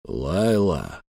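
low, drawn-out name; slow, deep-voiced appeal; drawled. A narrator's reading. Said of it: "протянул Эльм".

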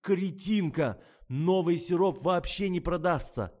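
high, loud outburst; despairing anger. A narrator's reading. Kostya clutches his head over sales, angrily despairing. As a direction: rail in despair over ruin. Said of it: "схватился за голову Костя".

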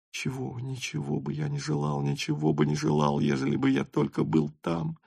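soft, dreamy inner voice; thinking aloud, hushed and longing; quiet inner thought. A narrator's reading. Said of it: "думал Ростов".

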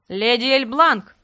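slow, loud call across a hall; man calling out to a lady. A narrator's reading. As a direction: call her name loudly from behind. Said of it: "громко позвал мужской голос".

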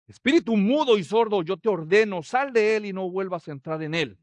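loud commanding rebuke; stern rebuke. Acted. Jesus rebuking the unclean spirit, loud command. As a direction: order the spirit out. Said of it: "reprendió al espíritu inmundo".

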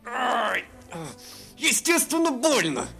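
growled through teeth. A narrator's reading. Elf snapping at the dragon in pain. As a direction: spit it through clenched teeth. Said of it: "прорычал сквозь зубы эльф".

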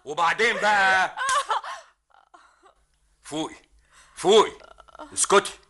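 high, loud orders; exasperated shout. Acted. Rostom yelling at hysterical Sabah to wake up. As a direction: snap her out of it.

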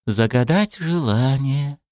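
pensive, to himself. Acted. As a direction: muse aloud, stretching the words. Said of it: "сказал задумчиво старик, будто сам себе". (задумчиво, будто сам себе)

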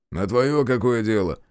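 ordinary delivery, nothing marked

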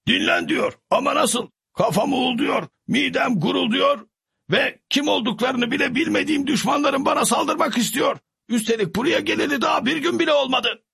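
high fretful servant voice, rising to cries; worried Sancho. A narrator's reading. Sancho complains to his squire, high and fretful. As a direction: Sancho frets aloud, his voice climbing in panic.

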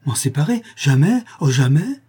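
exalted cry. A narrator's reading. The mother crying out she will never give up her child. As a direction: cry out refusing to part with the child. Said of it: "s'écria Mont-Saint-Jean avec exaltation".

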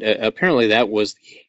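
normal talking voice

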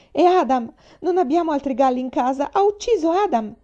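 high, loud dismay building to the last words; the worst has happened and it lands; horrified cry. Acted. Faith realises the cook killed her pet rooster, horrified.

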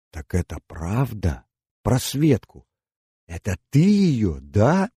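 rising, pressing, heartfelt call; heartfelt cry. Acted. Inna realises the truth, a heartfelt cry. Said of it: "крикнула она задушевно".

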